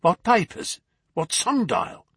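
high, loud puzzled outburst; startled father demanding answers; baffled questions. A narrator's reading. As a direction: snap back in disbelief.

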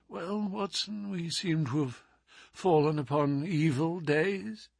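barely able to speak, frail; feeble voice. Acted. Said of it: "in a feeble voice".

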